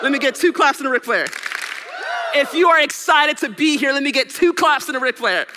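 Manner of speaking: loud, high hype call to the crowd. Real, not acted. Speaker hypes the audience for claps, loud and high. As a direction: pump the crowd up.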